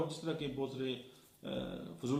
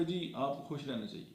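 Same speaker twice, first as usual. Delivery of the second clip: joyless; 4 dB quieter than usual. Shown as a demonstration. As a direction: say it without any happiness. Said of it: "वो खुशी की नहीं है".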